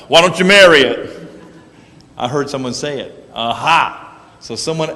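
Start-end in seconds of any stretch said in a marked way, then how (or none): 0.1-1.3 put-on loud, higher-pitched childish teasing; schoolyard taunt. Kid teasing someone who said they love something, loud taunt. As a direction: tease them loudly, like a kid in elementary school.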